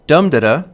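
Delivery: "dum-da-da" with a punched "dum", then two light beats; stress on first syllable. Shown as a demonstration. Lecturer demonstrating a dactyl to students, "dum-da-da" stressed on "dum". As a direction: hit "dum", drop away on "da-da". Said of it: "stressed syllable followed by two unstressed".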